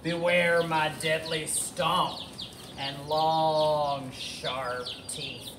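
slow, drawn-out monster warning; roaring dinosaur threat. Acted. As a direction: drag out the threat, letting it sink away. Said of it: "Tyrannosaurus roared again".

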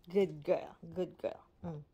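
ordinary talk

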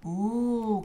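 long, drawn-out ooh of wonder; a storybook ooh of awe; wondering gasp. A narrator's reading. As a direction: gasp like amazed children. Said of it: "gasped the children".

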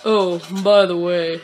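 low, easygoing character voice; put-on character voice. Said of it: "that's the pallet voice".

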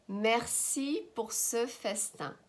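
"Merci pour ce festin" slowly in one phrase; joined-up slow model. Shown as a demonstration. Teacher repeats phrase joined up.

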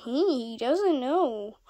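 normal talking voice

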